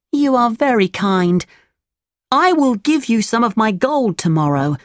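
put-on high puppet-boy voice; high storybook Pinocchio; grateful Pinocchio. A narrator's reading. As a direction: pitch it up, politely thankful.

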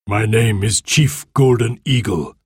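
measured, stately chief's voice; solemn chief. A narrator's reading. Golden Eagle meets Anthea under the flag, solemn. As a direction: announce yourself as a proud chief. Indian chief introduces himself.

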